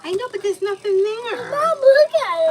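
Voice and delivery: high, loud tearful pleading; crying. Real, not acted. Hurt child pleading with mom, crying.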